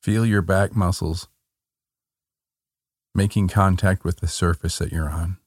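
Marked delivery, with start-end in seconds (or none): none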